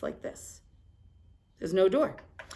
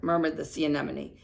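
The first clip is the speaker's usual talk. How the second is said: ordinary delivery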